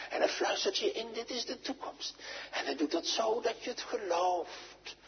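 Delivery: soft, intimate pulpit voice; hushed preaching. Acted. Preacher softly tells the church the Spirit whispers, hushed. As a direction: draw them in, close and soft.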